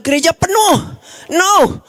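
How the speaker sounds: usual speaking voice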